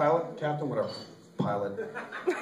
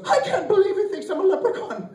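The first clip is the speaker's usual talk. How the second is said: much higher squeaky put-on voice; leprechaun voice; 11 semitones higher than usual. Comic doing the leprechaun's punchline in a high voice. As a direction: pitch it way up like a tiny leprechaun.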